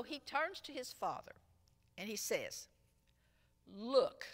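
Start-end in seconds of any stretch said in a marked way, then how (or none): 3.7-4.3 sharp, louder bitter "Look."; a resentful son's demand. Retold or imitated. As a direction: snap "Look." at the father with resentment. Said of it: "His bitterness could be heard".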